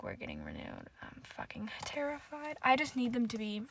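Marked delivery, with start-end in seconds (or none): 0.0-2.6 near-whisper, very soft; whispered worried confession; hushed dread. Real, not acted. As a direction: drop to an airy whisper, worried. Worried about show not being renewed.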